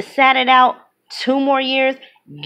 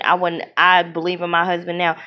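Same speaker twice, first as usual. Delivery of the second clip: ordinary delivery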